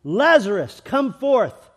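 loud high summons; commanding call. Retold or imitated. Voicing Jesus calling Lazarus from the tomb, loud. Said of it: "what it was for Lazarus".

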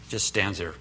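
usual speaking voice

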